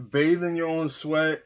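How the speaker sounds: normal talking voice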